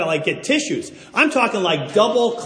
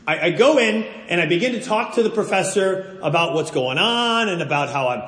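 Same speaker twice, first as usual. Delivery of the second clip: ordinary delivery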